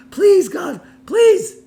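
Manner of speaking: high, loud pleading cry; desperate scream to God. Retold or imitated. Wounded girl cries out to God for rescue, screaming. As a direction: beg God with your last breath. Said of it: "she screams out to God".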